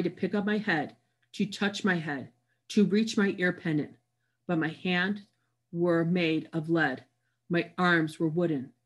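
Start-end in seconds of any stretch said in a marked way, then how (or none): none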